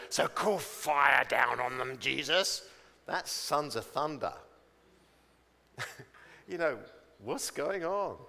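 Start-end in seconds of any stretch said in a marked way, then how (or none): 0.1-2.7 loud, slow hot-headed demand; fiery disciples. Acted. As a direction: play fiery young men, loudly calling for destruction. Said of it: "And they were like that".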